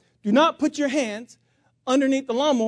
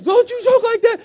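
high, loud, shrieked rebuke; wife's frantic scolding; 12 semitones higher, 9 dB louder than usual. Retold or imitated. Wife to the man joking about a match, frantic. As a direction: scold him at the top of your voice.

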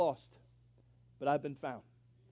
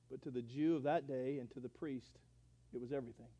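ordinary talk, nothing marked

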